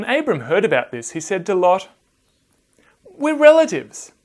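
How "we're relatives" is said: pleading appeal. Acted. Abram pleading with Lot.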